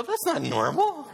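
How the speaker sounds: high, slow disbelieving voice; shocked Canadian friend. Retold or imitated. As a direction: play the appalled polite guest. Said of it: "the Canadian friends would go".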